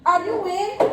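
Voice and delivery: high sing-song call at the door; calling out sweetly. Acted. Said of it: "I called".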